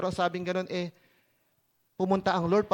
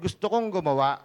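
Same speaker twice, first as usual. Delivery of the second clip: ordinary talk, nothing marked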